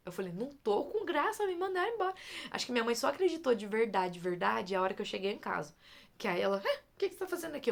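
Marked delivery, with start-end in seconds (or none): 0.3-2.1 high-pitched insistence, imitating herself upset; retold upset protest. Retold protest to disbelieving mother. 6.6-7.7 high, rushed, startled question; imitated startled mother. Mother's startled question on seeing her.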